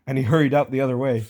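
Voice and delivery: ordinary delivery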